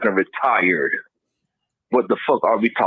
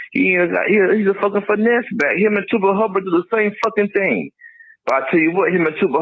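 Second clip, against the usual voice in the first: pitched way up, fired-up complaint; heated rant; 10 semitones higher than usual. Real, not acted. Caller venting to the hosts about the running backs, high and heated. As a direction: rant it high and hard at the backfield.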